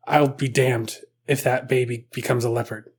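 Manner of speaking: lightly played solemn determination, kept down; low resolve. The Pope vowing to save the baby, low and subdued. The Pope's vow, acted.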